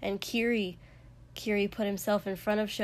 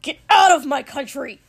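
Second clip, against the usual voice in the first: loud, high enraged yell; raging full-force shout; furious bellow; 6 semitones higher, 14 dB louder than usual. A narrator's reading. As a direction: roar it, throwing someone out. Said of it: "Katsuki said again".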